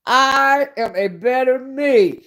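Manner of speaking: slow mantra for everyone to repeat. Shown as a demonstration. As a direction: intone it like a mantra, each word weighted. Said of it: "I'm gonna say it like a mantra".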